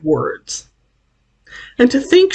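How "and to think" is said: sobbing. Acted. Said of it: "she sobbed".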